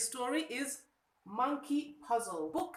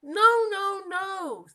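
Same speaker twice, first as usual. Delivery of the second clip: high, loud no no no; loud protest; 9 semitones higher, 11 dB louder, much slower than usual. Acted. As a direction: shout the denial. Monkey rejects spider.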